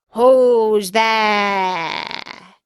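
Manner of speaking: loud gruff wolf shout; big wolf voice. Acted. Wolf in grandmother's bed, big shout. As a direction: boom it out like a wolf. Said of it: "the big voice of the wolf".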